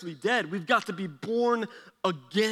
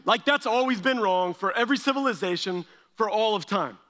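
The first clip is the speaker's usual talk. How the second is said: ordinary talk, nothing marked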